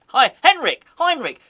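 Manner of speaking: ordinary talk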